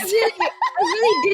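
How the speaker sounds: laughing. Real, not acted. Laughing about own personality.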